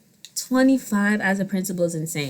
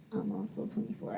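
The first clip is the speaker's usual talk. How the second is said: secret aside, barely voiced, much softer; whispered confession; 16 dB quieter than usual. Real, not acted. Confessing her own age to viewers, in a hush. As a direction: slip it in under your breath so nobody hears. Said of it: "I hope y'all didn't hear that".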